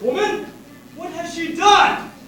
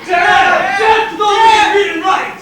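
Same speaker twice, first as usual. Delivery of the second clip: mob shout; 7 semitones higher, 11 dB louder than usual. Acted. Mob chanting for death.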